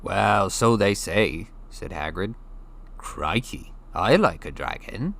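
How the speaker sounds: put-on a giant speaking casually to a boy; Hagrid, big friendly giant. A narrator's reading. Hagrid explaining to Harry, casual giant voice.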